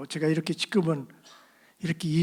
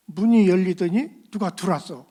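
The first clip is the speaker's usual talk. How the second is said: ordinary delivery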